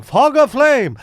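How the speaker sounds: loud, high Indian accent. Shown as a demonstration. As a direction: punch it in a hyped Indian accent. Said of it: "How do you say it with the Indian accent?".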